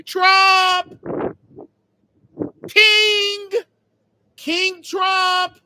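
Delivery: high, loud hail to the king; summoning shout. Pretend. Hailing King Trump to deport him, loud high shout. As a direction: cry it out like a herald.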